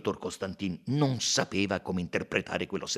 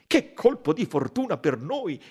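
louder, delighted exclamation; exclaiming doctor; 7 semitones higher, 7 dB louder than usual. A narrator's reading. Doctor to Poirot, exclaiming at the lucky clue. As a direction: cry out at the lucky find. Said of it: "esclamò il dottore".

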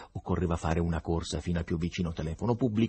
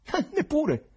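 high, foreign-sounding scoffing retort; professor's mocking voice; 4 dB louder, slower than usual. Professor scoffing at the writers' denial of the devil, high mocking voice.

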